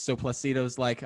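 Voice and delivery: ordinary delivery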